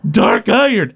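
high, insistent counter; mock-indignant retort; arguing. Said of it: "they both start arguing".

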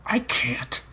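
near-whispered, breath-led refusal; hushed refusal. A narrator's reading. Man refusing his lover, hushed and reluctant.

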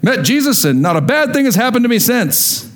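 high-pitched, overly positive claim; acted all-is-well boast; mock cheerful testimony. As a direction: put on a perfect-life front. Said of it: "and you go".

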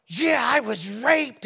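slow, loud and bitter; angry outburst. Retold or imitated. Girl confronting the preacher, angry and loud. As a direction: spit out each word in anger. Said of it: "you could see the anger rise up in her".

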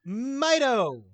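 high, loud eager shout of the answer; sudden loud answer, sure of yourself; excited blurt. Real, not acted. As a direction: blurt it out like you know it for sure.

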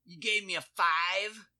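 high, loud, slow mock outrage; disgusted customer. Cashier imitating customer outraged by a five. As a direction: recoil at the bill like a snob. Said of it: "He's like".

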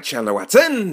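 high, loud mock-grand self-announcement; blown-up name. Comedian trying out saying his name big, animated and blown-up. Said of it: "You gotta blow your name up".

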